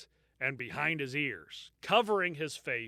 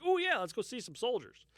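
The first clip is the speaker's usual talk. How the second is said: a hint of an eager little kid, pitched up; 8 semitones higher than usual. A lightly voiced child, eager to go see soldiers. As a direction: play the excited child jumping at the offer.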